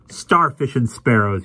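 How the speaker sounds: slow, loud outburst. A narrator's reading. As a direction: say it loudly and slowly. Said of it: "he said loudly".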